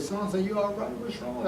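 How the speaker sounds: soft, hushed and high, asking what's wrong; gentle concern. Retold or imitated. Asking his crying wife what's wrong, soft and hushed. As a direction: coax it out of her softly, worried.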